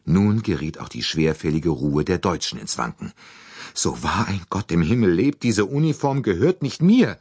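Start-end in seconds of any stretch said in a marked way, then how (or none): 3.7-7.2 higher, louder alarmed protest; a shocked maid protesting her innocence; crying out. A narrator's reading. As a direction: swear it's not hers, out loud. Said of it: "rief sie".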